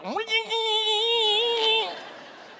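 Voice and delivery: long high laugh; high burst of laughter. Real, not acted. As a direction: let a big laugh break out. Laughing at the dumb teenagers.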